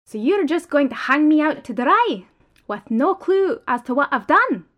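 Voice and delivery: loud, indignant accusation. Acted. Prisoner protests to boss.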